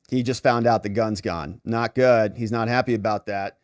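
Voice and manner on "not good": ordinary talk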